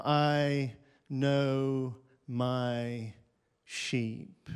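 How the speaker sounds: a hushed, tender close. Acted. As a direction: let it fall gently, almost intimate. Quoting Jesus softly.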